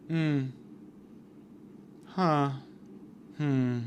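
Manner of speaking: soft, spaced-out disappointed hums; little pointed "hmm" sighs; disapproving sighs. Acted. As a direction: sigh quietly in mock dissatisfaction. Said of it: "sighs that let her know".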